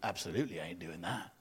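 low, soft mock inner voice; inner grumble. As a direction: grumble the truth under a fake yes. Voicing a fake yes, inner no.

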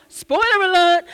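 a playful loud exclamation of spoiler alert; mock loud warning. Real, not acted. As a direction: blurt the warning like a mock announcement. Mock warning amid the cinema story.

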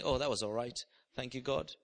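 low, understated mock composure; mock dignified. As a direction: play it dignified and unimpressed. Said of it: "walking out dignified saying".

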